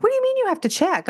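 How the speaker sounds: high, fast indignant question; incredulous. Retold or imitated. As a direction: fire it off in disbelief. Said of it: "I'm like".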